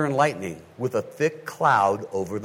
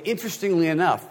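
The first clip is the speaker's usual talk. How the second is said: usual speaking voice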